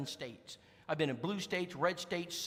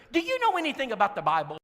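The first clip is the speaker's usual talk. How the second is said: higher, louder, fast eager question; Brenda's voice; 7 semitones higher, 9 dB louder, faster than usual. Retold or imitated. Preacher voices Brenda asking.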